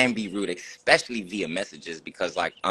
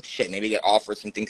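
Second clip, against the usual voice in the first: normal talking voice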